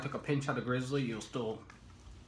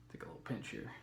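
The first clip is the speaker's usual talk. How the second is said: whisper; 9 dB quieter, faster than usual. Real, not acted. Taking a pinch of dip.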